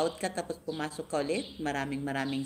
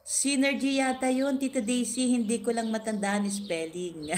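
reading a comment; 8 semitones higher, 3 dB louder than usual. Retold or imitated. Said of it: "Sabi ni Madam".

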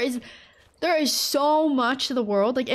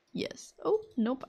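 ordinary delivery

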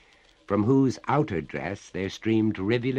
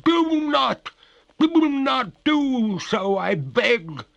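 very high, loud, slow ghostly plea; crying out; 9 dB louder, slower than usual. A narrator's reading. Ghost begs the master not to stop, wailing. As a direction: beg in a drawn-out wail. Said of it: "cried the ghost".

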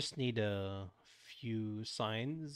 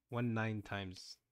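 "One nine times" said at half speed, "One" stretched and stressed; slow deliberate; much slower than usual. Shown as a demonstration. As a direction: spell out "One... nine times" slowly to a child. Repeats intended answer slowly.

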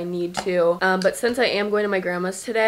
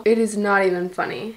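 ordinary talk, nothing marked

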